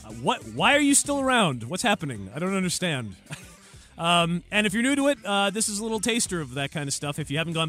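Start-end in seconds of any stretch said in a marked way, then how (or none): none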